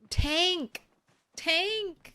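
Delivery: high excited shout of a name; delighted squeal of a viewer's name; excited call. Real, not acted.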